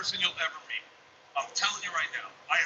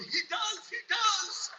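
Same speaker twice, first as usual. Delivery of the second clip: put-on high, eager kid voices; imitated little girls; much slower than usual. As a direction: pipe up high as his excited daughters. Voices his daughters cheering him on.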